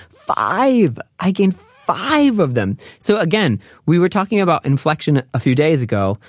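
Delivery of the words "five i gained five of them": five stretched and pushed high; emphatic five. Retold or imitated. Girl stressing how much she gained, emphatic. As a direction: hit five hard like it's shocking. Teacher voicing the dieting girl.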